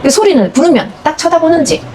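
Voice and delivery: usual speaking voice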